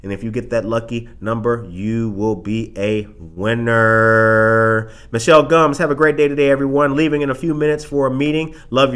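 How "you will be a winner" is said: slow game-show announcer voice; drawn-out announcer. Acted. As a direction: stretch it like a prize reveal. Announcing raffle winner.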